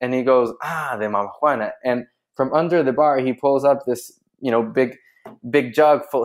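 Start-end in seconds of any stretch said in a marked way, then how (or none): none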